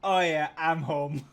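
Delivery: loud, mock-delighted sarcasm; sarcastic. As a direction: cheer it with ironic hometown pride. Sarcastic reaction to hometown kids.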